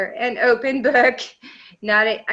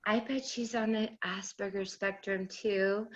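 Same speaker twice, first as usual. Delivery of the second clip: soft inner voice; 10 dB quieter than usual. Retold or imitated. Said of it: "to them going".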